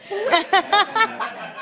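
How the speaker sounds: high, slow laughing agreement. Real, not acted. Comic answering the crowd's cheer, laughing. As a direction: ride the laugh.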